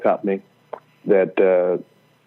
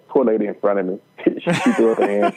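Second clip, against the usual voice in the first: ordinary talk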